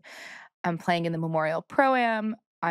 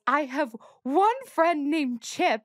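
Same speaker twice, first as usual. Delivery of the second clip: lightly imitating a posh English friend, a bit higher; a hint of upper-class English, mocking the friend; 7 semitones higher than usual. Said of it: "accusing her of being posh".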